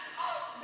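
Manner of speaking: sharp high mock gasp; imitating gasp. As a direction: gasp like the shocked therapist. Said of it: "and gasped".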